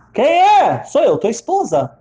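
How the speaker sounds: high, loud acted call; calling through door. Locked-out wife and husband at the door, calling out.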